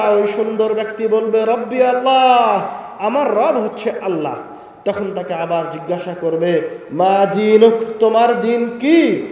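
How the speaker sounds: loud, high fervent preaching; preaching at full force. Acted. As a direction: call it out to the crowd. Preacher voices grave questioning loudly.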